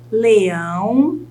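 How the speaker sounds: "leão" with a long rising ÃO; stressed syllable exaggerated. Shown as a demonstration. Teacher has students find the stress, "leão" stretched on ÃO. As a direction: hold the end of "leão", letting it rise. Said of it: "tente analisar qual dessas sílabas é a sílaba tônica".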